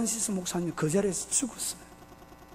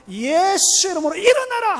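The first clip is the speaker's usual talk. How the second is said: commanding shout; 9 semitones higher, 6 dB louder than usual. Acted. Pastor commanding the dead man to rise, commanding shout. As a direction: shout it with full authority. Preacher acts out commanding prayer over corpse.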